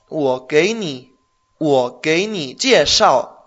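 wo gei ni jieshao very slow, jieshao falling; slow model phrase. Shown as a demonstration. Teacher models the phrase slowly for the learner. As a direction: stretch each syllable. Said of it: "con el verbo jieshao".

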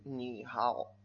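"ni hao" with both syllables sunk low; two third tones. Shown as a demonstration. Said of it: "Eso no es cómodo". ("ni hao" con dos terceros tonos, grave)

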